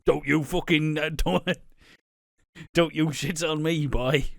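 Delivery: put-on angry eight-year-old. Kid threatening his mum during Monopoly, mock angry. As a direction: snap like a furious little boy. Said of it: "it's like an eight-year-old".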